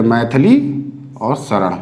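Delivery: मैथिली and शरण said slowly as two separate words; slow, low, word by word मैथिली और शरण; broken into parts. Shown as a demonstration. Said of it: "तोड़ के भी पढ़ सकते हैं".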